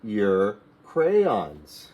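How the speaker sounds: "Take out your crayons" said very slowly and clearly; slow model phrase. Shown as a demonstration. Teacher modelling a command to learners, very slow. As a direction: stretch each word for beginners. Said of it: "we'll say".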